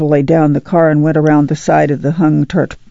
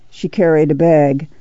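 plain reading voice

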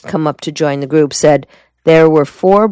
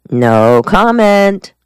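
slow, smiling no comment; amused; 6 semitones higher, 5 dB louder, much slower than usual. Acted. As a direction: savor it with a smile. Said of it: "Mason said, smiling".

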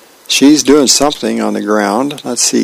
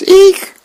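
loud, very high squeal; comic horrified shriek; 6 dB louder than usual. Acted. Girl to Dad on seeing her top knot, high comic shriek. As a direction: let out a cartoon "eek".

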